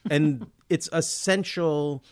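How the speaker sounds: ordinary delivery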